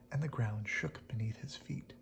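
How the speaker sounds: plain reading voice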